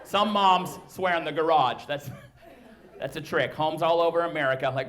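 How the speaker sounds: ordinary talk, nothing marked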